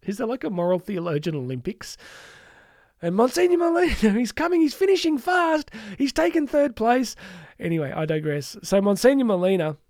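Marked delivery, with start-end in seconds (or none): 3.0-7.2 excited, high-pitched race call; hammed-up Olympic commentary, pitched way up; mock sports commentator. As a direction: call the final stretch like a commentator.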